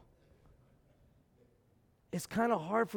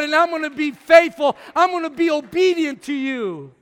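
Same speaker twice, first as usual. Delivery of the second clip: shouted prayer; 6 semitones higher, 12 dB louder than usual. Acted. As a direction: cry out to God in full voice. Voiced prayer at full force.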